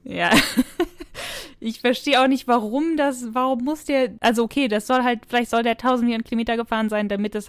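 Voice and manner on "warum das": normal talking voice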